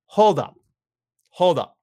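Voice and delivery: loud, disbelieving hold up. Retold or imitated. Host stopping his co-host, loud and disbelieving. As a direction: stop everything, call it out loud. Incredulous reaction to wrestler's choice.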